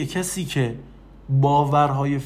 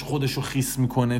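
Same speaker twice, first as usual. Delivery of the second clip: usual speaking voice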